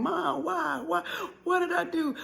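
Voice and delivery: high, acted wail without shouting; child wailing to mother; crying plea. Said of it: "crying, screaming".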